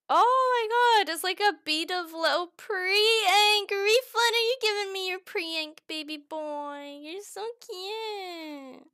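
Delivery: high, slow cooing at a pet; baby talk. Pretend. Streamer coos at her glue gun like a baby, high and slow. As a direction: coo over it like a baby.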